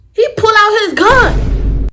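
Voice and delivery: loud, high dramatic exclamation; high-pitched alarmed yell; shocked shout. Retold or imitated. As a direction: blurt it out at full volume, drawn out. Shocked climax of her gun story.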